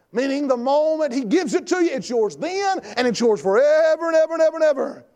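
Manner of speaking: high, forceful pulpit shout; preaching at full force. Acted. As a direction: drive each point home to the congregation.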